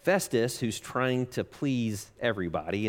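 ordinary delivery

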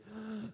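re-enacted gasp for air after shoveling; short quiet mock gasp; breathless gasp. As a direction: gasp like you can't breathe. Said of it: "I couldn't breathe".

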